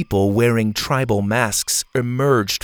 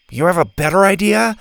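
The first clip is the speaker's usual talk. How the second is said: higher, louder challenging question; defensive retort; 8 semitones higher, 5 dB louder, faster than usual. A narrator's reading. Bo answers Zeta's doubt, defensively. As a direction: throw it back, a bit indignant. Boy retorts to raised eyebrow.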